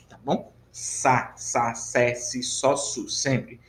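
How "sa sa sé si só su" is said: "Sa, sa, sé, si, só, su" with a hissed S, never Z; S sound demonstration. Shown as a demonstration. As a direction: separate syllables, each opening on a voiceless S. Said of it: "sempre vai ter o som de S de sapo".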